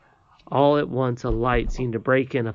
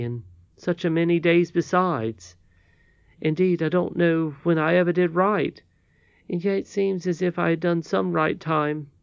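put-on higher, earnest voice of a boy confessing; contrite Curdie; 6 semitones higher, 4 dB louder than usual. Curdie confesses to the old lady after killing her bird, earnest and contrite. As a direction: own up to wrongdoing, pitched up and louder.

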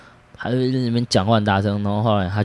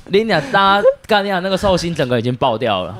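ordinary talk

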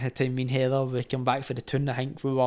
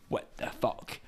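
shocked whisper; 13 dB quieter, much slower than usual. Retold or imitated. Girlfriend stunned after knife encounter.

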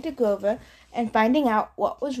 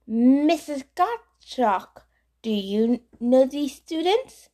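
put-on French accent; 2 semitones higher, slower than usual. French-accented teacher asks colleague.

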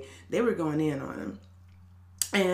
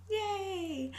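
small high cheer; 4 dB quieter than usual. Real, not acted. Happy friend listens to show.

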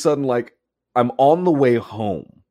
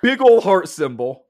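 reading a fan note; 8 semitones higher, slower than usual. Retold or imitated. Reading out Barbara's fan message.

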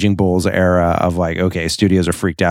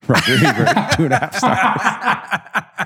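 laughing; 9 semitones higher, slower than usual. Real, not acted. Laughing at Ebert's review.

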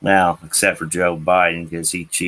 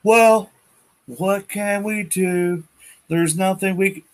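mocking helpless whine; 12 semitones higher than usual. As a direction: play the shrugging weakling.

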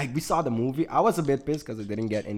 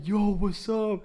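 put-on high drunk swagger; imitating drunk friend; 8 semitones higher, much slower than usual. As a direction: play the drunk friend showing off. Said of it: "he starts like".

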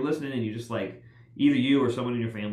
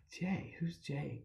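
puzzled hushed question; 4 semitones higher, 11 dB quieter, much slower than usual. Retold or imitated. Confused about stranger in room.

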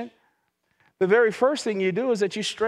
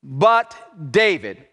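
slow, low "But" in "But David"; drawn-out emphasis; 5 semitones lower, 6 dB louder, much slower than usual. Acted. Pastor pausing the congregation on David, slow and low. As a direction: hold "But" long before "David". Preacher slows on key phrase.

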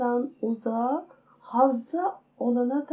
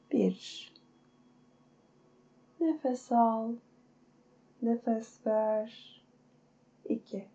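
guided meditation. Acted. Counts breaths in meditation.

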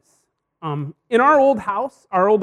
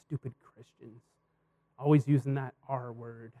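low, quiet scoffing mutter; dismissive sneer; 8 semitones lower, 10 dB quieter than usual. Retold or imitated. A skeptic scoffing at Christians, quiet and dismissive. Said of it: "hear the words repent and say".

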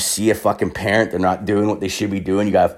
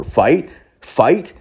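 goading challenge; 6 semitones higher than usual. Retold or imitated. Motioning the dad over, fists up.

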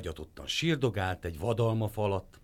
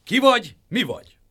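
much higher, loud challenge; clanging guard; 11 semitones higher, 11 dB louder than usual. A narrator's reading. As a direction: stop the intruder. Pot guards halt Dur.

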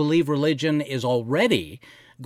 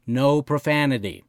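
ordinary talk, nothing marked

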